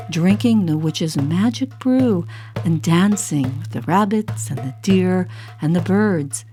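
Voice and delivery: ordinary delivery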